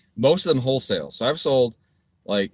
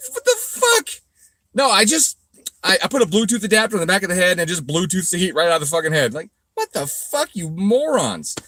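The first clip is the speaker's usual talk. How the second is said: much higher, louder incredulous outrage; exasperated yelling; 9 semitones higher, 5 dB louder than usual. Real, not acted. Ranting at commenters about his cooling mods. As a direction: throw it at them in disbelief.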